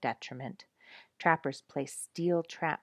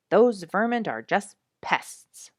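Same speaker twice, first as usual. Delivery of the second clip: disgruntled judge; 4 semitones higher, 9 dB louder, slower than usual. Acted. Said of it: "said the judge".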